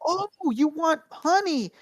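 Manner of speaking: high, slow cooing question; imitated server. Mexican server offering a child honey, imitated coo. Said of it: "she was like".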